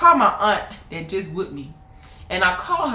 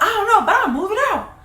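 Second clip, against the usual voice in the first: put-on high child's declaration; high stubborn kid voice; defiant child; 13 semitones higher than usual. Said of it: "I was like".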